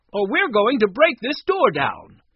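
loud, high threat; demanding. A narrator's reading. Woman threatening to break in, demanding. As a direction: threaten through the door. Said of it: "she demanded".

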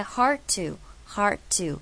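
HARD TO slowly, with no released d; D省略. Shown as a demonstration. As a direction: glide from "hard" into to, dropping the d. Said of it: "D是被我省略的". (慢慢念hard to，省略D)

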